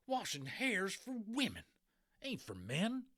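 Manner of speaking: high, loud gruff cowboy voice, appalled; outraged old cowboy; cowboy character. Acted. Boone the cowboy protests.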